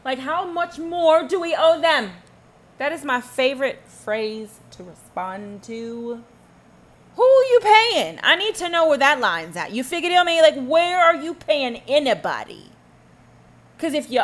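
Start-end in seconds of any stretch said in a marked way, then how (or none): 0.1-2.1 1 mock complainer. 7.2-9.7 indignant loud demand; loud challenge. Real, not acted. Angry challenge to complainers.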